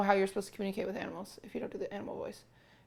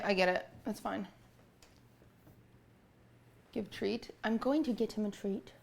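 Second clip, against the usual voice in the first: normal talking voice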